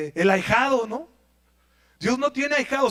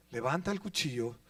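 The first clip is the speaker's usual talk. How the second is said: usual speaking voice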